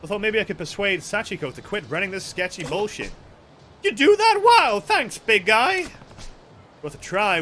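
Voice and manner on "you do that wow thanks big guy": put-on very high, loud girly voice; gushing girl. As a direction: squeal your thanks to a hero. Voicing grateful game girl.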